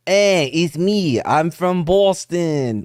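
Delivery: hammed-up Boston newborn introducing himself; Boston accent. First baby pops out already talking, exaggerated Boston accent. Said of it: "the first baby pops out and he's like".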